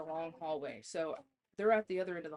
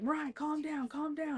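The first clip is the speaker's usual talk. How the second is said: pleading mom; 9 semitones higher, 3 dB louder than usual. Retold or imitated.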